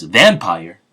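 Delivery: mildly acted surprise, voice jumping up; loud, astonished echo of a retold reaction; surprised question. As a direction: act out a startled double-take. Luffy reacts to hearing vampire.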